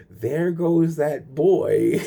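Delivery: lightly voiced snooping observer; sly watcher. Imagined watcher spotting him.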